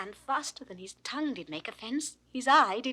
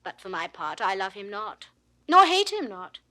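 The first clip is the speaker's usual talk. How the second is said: ordinary delivery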